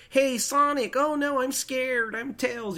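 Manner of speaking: high, mock-fearful sidekick voice; Tails, scared. Host mocking Tails calling to Sonic, pretend scared. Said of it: "always scared".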